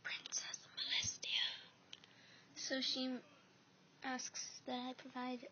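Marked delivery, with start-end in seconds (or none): 0.0-1.5 whisper. Acted.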